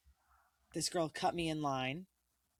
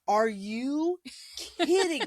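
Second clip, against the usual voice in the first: "Are you kidding?" much higher and louder, rising on "kidding"; a kid who can't believe the accusation; incredulous outburst; 8 semitones higher, 7 dB louder, much slower than usual. Retold or imitated. Retold outrage at classmate, age seven.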